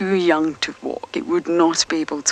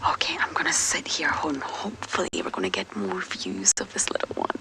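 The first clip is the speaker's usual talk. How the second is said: soft hushed whisper; 6 semitones lower, 6 dB quieter than usual. Real, not acted. Presenter sitting quietly by the den, whispering to viewers. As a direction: settle in and whisper. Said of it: "I don't want to startle it".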